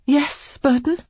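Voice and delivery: shaky, high and strained; unsteady. A narrator's reading. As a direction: answer with a tremor. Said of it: "she asked unsteadily".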